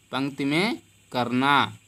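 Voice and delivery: ordinary talk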